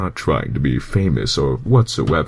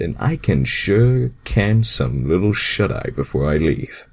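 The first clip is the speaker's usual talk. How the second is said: ordinary delivery, nothing marked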